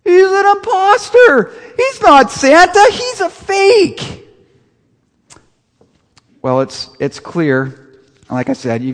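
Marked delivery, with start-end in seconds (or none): 0.0-4.3 high, loud, slow screaming. Retold or imitated. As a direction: scream it like outraged children. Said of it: "Buddy and all the children scream".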